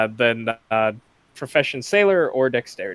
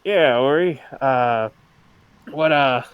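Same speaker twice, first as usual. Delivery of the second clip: put-on hungover old captain; 3 semitones higher, 6 dB louder than usual. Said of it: "And he says".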